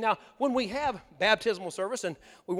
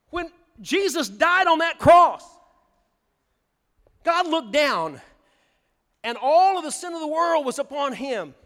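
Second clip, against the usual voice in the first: full-force preaching; 6 semitones higher, 5 dB louder than usual. Acted. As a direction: preach it out to the whole congregation.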